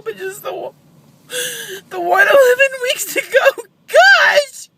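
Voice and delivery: sobbing, high and loud; wailing through tears; snotty crying. Real, not acted. Grieving mother breaks down.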